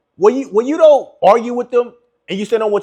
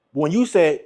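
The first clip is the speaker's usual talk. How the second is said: ordinary talk, nothing marked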